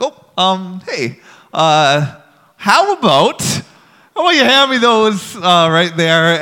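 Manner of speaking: mock-nervous, stalling with ums; nervous coaxing. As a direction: re-enact a careful, stammered negotiation. Nervously talking a kid out of picks.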